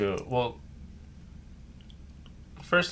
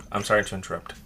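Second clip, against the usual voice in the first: normal talking voice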